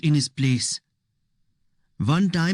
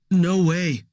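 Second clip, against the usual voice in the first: blurted; 3 semitones higher than usual. A narrator's reading. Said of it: "he blurts".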